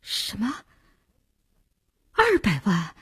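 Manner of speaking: startled disbelief at the sum; stunned. A narrator's reading. Lin reacting to her partner's two-million order, stunned. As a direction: echo the figure as if stunned. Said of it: "把林星给惊住了".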